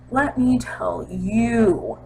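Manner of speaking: drawn out slowly; slow knowing repeat; slow emphatic. Real, not acted. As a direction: stretch it for effect. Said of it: "Let me tell you, folks".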